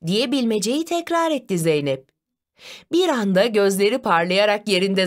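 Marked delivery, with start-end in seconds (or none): none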